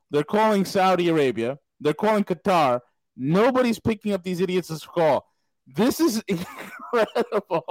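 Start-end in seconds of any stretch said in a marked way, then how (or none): 5.7-7.6 slow, higher words broken by laughter; incredulous laughing. Real, not acted. Laughs in disbelief at the situation.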